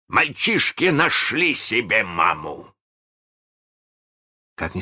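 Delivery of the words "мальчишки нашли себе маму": high, slow, forceful cry of alarm; exclaiming. A narrator's reading. Hook announces the boys found a mother, exclaiming. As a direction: proclaim it like a dismayed pirate captain. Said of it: "воскликнул Крюк".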